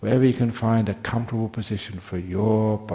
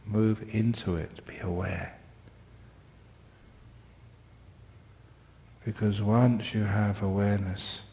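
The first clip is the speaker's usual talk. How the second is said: guided meditation; 3 dB quieter than usual. Real, not acted. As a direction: ease listeners into stillness gently. Leads the class into meditation.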